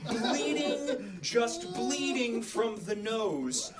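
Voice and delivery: words squeezed through laughter, high and slow; laughing. Real, not acted. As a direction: laugh while telling it. Laughing retelling.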